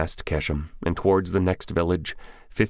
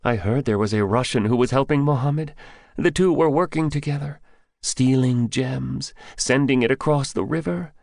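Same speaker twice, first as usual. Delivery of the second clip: higher, lightly voiced young Afghan man; higher young man telling what he knows; young villager Bidar; 9 semitones higher than usual. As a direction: voice the scared young grocer confiding.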